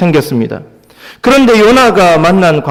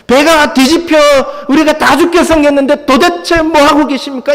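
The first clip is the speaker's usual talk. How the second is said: high-pitched panicked outcry; acted frantic, indignant pleading; desperate reproach; 9 semitones higher than usual. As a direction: cry out in alarm to wake the teacher. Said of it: "이렇게 얘기하지 않았을까요?".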